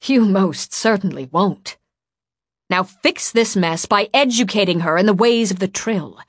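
loud, emphatic, severe orders; stern command. A narrator's reading. Displeased woman orders the boy to fix the mess, stern. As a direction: lay down the law.

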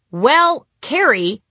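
high, loud drawn out stall. Retold or imitated. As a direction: stall for time on stage. Stalling after forgetting line.

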